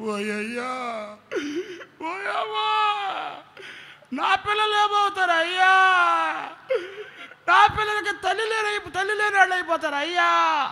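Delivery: high, loud wailing cry; panicked wife wailing. Retold or imitated. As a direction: wail for the children in panic. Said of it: "అని లేచిందంట". (భర్తతో భయంతో ఏడుస్తూ అరిచే భార్య)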